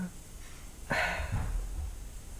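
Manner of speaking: long, soft unvoiced sigh; heavy sigh. Real, not acted. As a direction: let out a weary breath. Pausing while telling of being broken.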